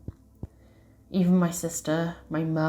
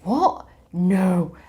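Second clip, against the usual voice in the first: shocked disbelief; 2 semitones higher, 5 dB louder than usual. Retold or imitated. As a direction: blurt it out in shock. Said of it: "They were both freaking out".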